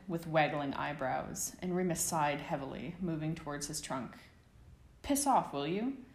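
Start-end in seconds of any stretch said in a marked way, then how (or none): none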